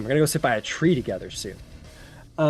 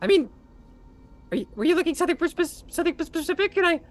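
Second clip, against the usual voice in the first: high loud squeaky begging and flattery; grovelling kobold; 3 dB louder than usual. Acted. Kobold flattering his captor, squeaky and desperate. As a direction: fawn desperately in a tiny high voice.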